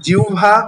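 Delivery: জিহ্বা said as "jiu-bha"; jiu-bha pronunciation. Shown as a demonstration. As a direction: model জিহ্বা with হ turned to "u" and ব to "bh". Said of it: "এটার উচ্চারণটা কিন্তু".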